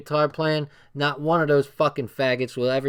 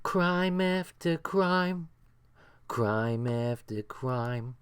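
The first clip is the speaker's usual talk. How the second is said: slow mock voice; 5 dB quieter, much slower than usual. Repeating phrase in slow voice.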